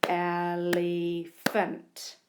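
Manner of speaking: elephant drawn out very slowly; stretched word. Shown as a demonstration. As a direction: stretch elephant to hear each sound. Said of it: "the third syllable".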